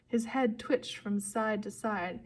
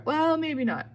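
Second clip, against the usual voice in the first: high, loud backtrack; high-pitched shouted retraction; sheepish correction; 7 semitones higher, 6 dB louder than usual. A narrator's reading. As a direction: call out a hasty correction. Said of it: "Grover corrected".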